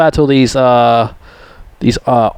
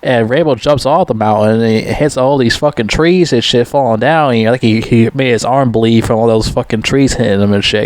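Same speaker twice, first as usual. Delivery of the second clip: ordinary talk, nothing marked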